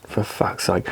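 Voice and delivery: whispered exasperated curse; under his breath. Real, not acted. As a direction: mutter it to yourself in a whisper.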